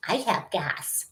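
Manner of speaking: imitating a silly young voice, softly; mock little-boy announcement. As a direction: put on a goofy kid-like voice. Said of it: "his silly young voice".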